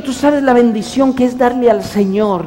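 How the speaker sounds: ordinary talk, nothing marked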